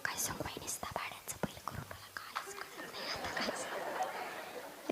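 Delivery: whispered gossip complaint; confiding whisper. Acted. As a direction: whisper it to a neighbour so nobody overhears. Acts mother-in-law confiding complaint.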